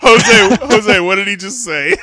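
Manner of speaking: loud laughing call across the room. Real, not acted. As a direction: shout the name, cracking up. Calling out to Jose, laughing.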